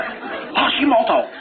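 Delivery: put-on drawn-out, high Japanese-accented battle cry; exaggerated guttural Japanese-accented cry; mock Japanese kung fu. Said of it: "getting ready for some kung fu here".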